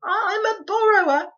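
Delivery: high, loud tearful cry; boy's cry. A narrator's reading. Boy denying he is a thief, high tearful cry. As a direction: cry it out high, lips trembling.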